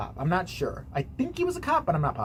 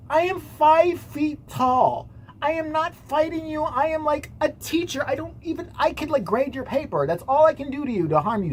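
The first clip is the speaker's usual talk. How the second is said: mock exasperated teacher; 5 semitones higher than usual. Small teacher reasoning with officer, mock exasperation. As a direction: spell it out in a high pleading voice.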